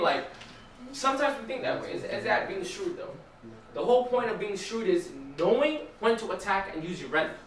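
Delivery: ordinary delivery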